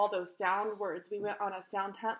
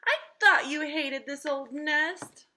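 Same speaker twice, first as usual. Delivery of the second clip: high, loud, slow amazement; astonished bird; 6 semitones higher, 7 dB louder, slower than usual. Acted. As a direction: still reeling from the surprise. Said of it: "gasped Mister Bird".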